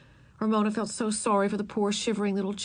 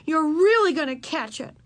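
high, loud sisterly threat; storming; 9 semitones higher, 6 dB louder than usual. A narrator's reading. Beezus to disobedient Ramona, storming. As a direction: warn with heated emphasis. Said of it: "stormed Beezus".

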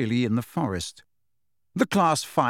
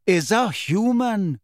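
slow, higher, plain statement; lightly voiced centaur stating fact; calm centaur; 7 semitones higher, 3 dB louder, slower than usual. A narrator's reading. Firenze cuts in.

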